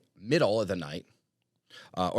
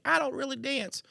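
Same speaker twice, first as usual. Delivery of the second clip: much higher reluctant guest voice; high mock old guest; 14 semitones higher, 3 dB quieter than usual. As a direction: squeak it like a shy old guest. Older guests declining.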